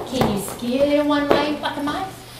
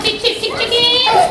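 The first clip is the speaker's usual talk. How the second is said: chick calls; 9 semitones higher, 4 dB louder than usual. Acted. Leader imitates chicks in farm dance.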